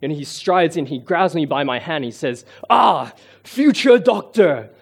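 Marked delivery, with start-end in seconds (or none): 2.7-4.7 slow, loud, higher booming greeting; booming general. Retold or imitated. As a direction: hail him like a powerful general. Imitates the tall general greeting him.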